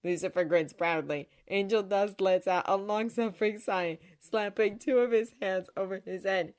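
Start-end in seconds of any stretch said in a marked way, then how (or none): none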